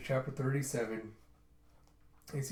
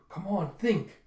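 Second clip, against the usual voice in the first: higher-pitched self-prodding; urging himself; 6 semitones higher, 3 dB louder, slower than usual. Retold or imitated. Yun, stuck below deck, urging himself on. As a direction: push yourself to think, voice rising.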